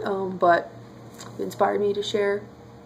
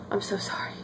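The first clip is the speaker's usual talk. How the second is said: soft whisper; 8 dB quieter than usual. Retold or imitated. Neighbours consoling her after movie.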